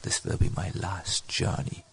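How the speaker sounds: low, hushed private resolve; soft, low self-talk; said to himself. Acted. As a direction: murmur it inwardly, barely voiced. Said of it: "Rup said to himself".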